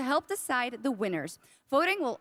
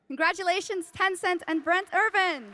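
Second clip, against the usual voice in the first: raised pitch, celebratory stage announcement; rousing congratulations; 5 semitones higher than usual. Real, not acted. Show host to award winner, rousing congratulations. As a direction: send the winner off with a lifted cheer.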